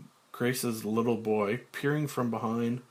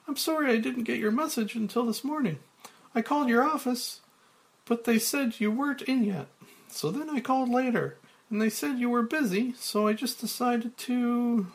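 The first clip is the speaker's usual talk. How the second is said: high, fast, breathless woman's voice; Claire out of breath; 13 semitones higher than usual. Acted. Claire arriving late to Frank, fast and breathless. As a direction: rush the words as if she just ran in. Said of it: "Claire, panting".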